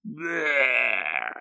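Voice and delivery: a short comic death croak, low and soft; mock dying gasp. Crocodile dies, comic low death croak. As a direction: die with a silly bleh.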